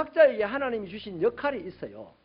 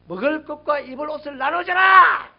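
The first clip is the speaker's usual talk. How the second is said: loud, raised proclamation; preacher's shout; 5 semitones higher, 10 dB louder than usual. Retold or imitated. John the Baptist crying out to the haves, shouting. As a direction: cry out the command, building to the last word. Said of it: "이렇게 소리쳤어요".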